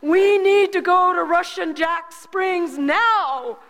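slow, high, loud call across the cliff; hollering. Retold or imitated. Mother calling Henry back up from the cliff, loud and slow. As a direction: shout it slowly down the hill to him. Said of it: "I hollered over".

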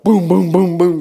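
loud, heavy repeated booms; mimicked shotgun blasts. Preacher imitates shotgun blasts coming at him, loud booming. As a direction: boom it out like gunfire.

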